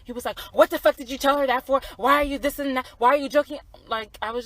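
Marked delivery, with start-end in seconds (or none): 0.5-3.6 higher and louder angry retort; imitating his snap. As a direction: re-enact him snapping at her.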